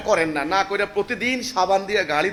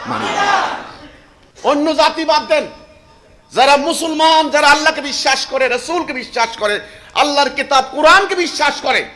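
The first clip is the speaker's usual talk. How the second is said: higher and much louder, preaching at full force; fiery preaching; 10 semitones higher, 10 dB louder than usual. Acted. Preacher rousing Muslims to obey the Quran, loud fervent preaching. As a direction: thunder it from the pulpit.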